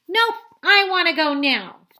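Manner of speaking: high, loud flat refusal; stubborn yell. Acted. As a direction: yell it like a stubborn little kid. Toddler refuses her big sister.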